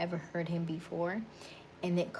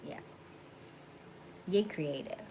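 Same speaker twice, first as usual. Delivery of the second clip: ordinary talk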